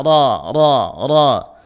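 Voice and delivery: "ra" thick and rounded, one tap; heavy ra. Shown as a demonstration. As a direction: stress the "ra" full and heavy. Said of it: "ra fathah".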